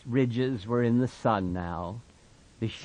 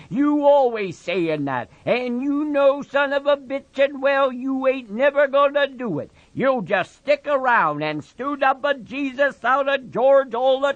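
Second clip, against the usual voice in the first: put-on higher, louder scolding old woman; sharp scolding aunt; 14 semitones higher, 5 dB louder than usual. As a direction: keep nagging him hard. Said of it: "she said sharply".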